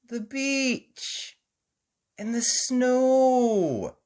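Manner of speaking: high, slow wonder; amazed. A narrator's reading. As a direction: marvel at it. Mummy amazed at snow.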